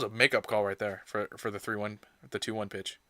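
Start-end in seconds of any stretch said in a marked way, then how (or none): none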